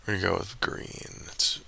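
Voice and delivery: slow whispered musing; whispering. Real, not acted. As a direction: whisper it to yourself slowly. Whispered bet talk.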